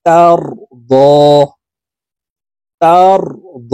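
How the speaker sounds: the দ after তার held one alif long; long দ vowel, one count held; one alif stretch. Shown as a demonstration. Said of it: "দদকে একালিপ টেনে পড়ব".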